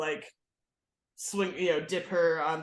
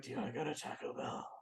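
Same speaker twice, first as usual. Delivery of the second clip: creepy whisper; 2 semitones lower, 13 dB quieter, faster than usual. Retold or imitated. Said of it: "the guy was like".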